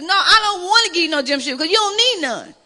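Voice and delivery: ordinary delivery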